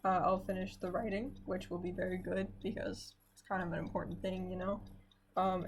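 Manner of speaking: ordinary talk, nothing marked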